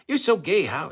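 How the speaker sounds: high, loud mock shriek of a crazy woman; mock Alice outburst. Host quoting Alice's outburst, loud high mock shriek.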